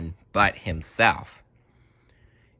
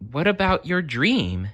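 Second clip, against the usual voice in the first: put-on eager girl; 9 semitones higher than usual. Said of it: "she asked".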